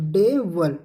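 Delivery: "डेवल" slowly split as day-vul; syllable demonstration. Shown as a demonstration. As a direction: stretch "डेवल" in two parts, schwa in the second. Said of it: "अब यहाँ पे वल होगा". ("डेवल" को तोड़कर डे-वल बोलें)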